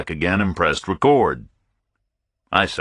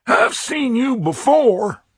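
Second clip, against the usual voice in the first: higher, pinched voice of a busy pompous captain; fussy fat captain; slower than usual. A narrator's reading. Captain dismissing a stranger, higher pompous voice.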